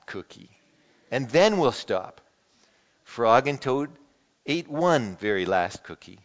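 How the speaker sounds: lively read-aloud with voiced characters; animated picture-book reading; storybook reading. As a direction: read it like a children's story to the congregation. Reads Frog and Toad aloud.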